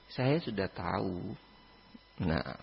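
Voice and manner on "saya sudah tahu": calm. Retold or imitated. Prophet calmly answers Aisyah.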